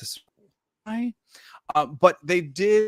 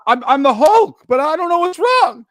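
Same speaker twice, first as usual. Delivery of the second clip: confused Morph; 12 semitones higher, 9 dB louder than usual. Pretend. Voicing confused Hulk Morph.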